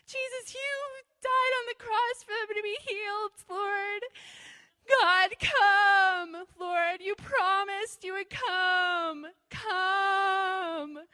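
high, drawn-out tearful pleading; hammed-up wailing plea to God; mock crying prayer. Preacher mimicking herself weeping over the sick, mock crying. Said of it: "I'm, like, crying over them".

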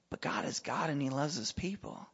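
ordinary talk, nothing marked

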